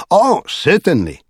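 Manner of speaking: high, swooping, delighted king; enthusiastic declaration. A narrator's reading. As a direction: exclaim it with eager royal delight. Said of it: "clapping his hands together in his enthusiasm".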